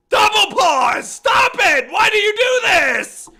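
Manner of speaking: loud, high yelling at the game; frustrated shout. Real, not acted. Gamer yelling at the game, exasperated shout. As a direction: scream in exasperation at the screen.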